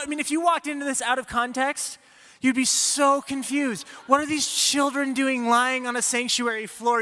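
ordinary talk

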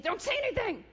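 high-pitched, sudden mock scolding; scolding interruption. God interrupting the promise, high insistent command. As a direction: cut in over the meek reply, voice jumping up.

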